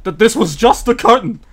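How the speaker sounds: high, loud cartoon bear confrontation; Yogi Bear. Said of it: "Yogi Bear rips back open the curtain. He's like".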